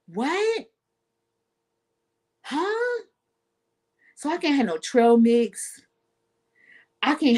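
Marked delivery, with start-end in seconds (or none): 0.1-3.0 mock confusion. Mock confused reaction, far higher.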